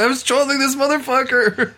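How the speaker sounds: high, mock indignant voice; mock indignant friend. Teasing friend about his angry online rant, high mock outrage. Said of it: "'Cause you're like".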